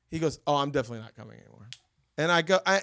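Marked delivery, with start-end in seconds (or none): 0.5-1.7 quick, low, soft sulky mumble; imitating a sulking son; sulky brush-off. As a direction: rush it out like a hurt teen backing off. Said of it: "you could tell in his voice".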